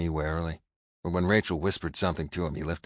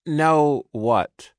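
female voice; 10 semitones higher, 9 dB louder than usual. A narrator's reading. Sister asks narrator.

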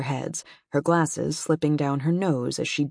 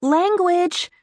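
put-on high, loud little-boy scolding; kid admonishing; 6 dB louder than usual. A narrator's reading. Son scolds Dad from the couch, high and loud. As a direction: tell Dad off like a prim eight-year-old. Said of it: "admonished Riley from the couch".

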